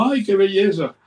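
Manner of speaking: high, charmed sigh of admiration; delighted wife. Retold or imitated. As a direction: lift it up like she's enchanted.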